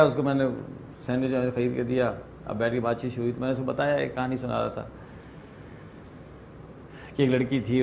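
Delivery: ordinary talk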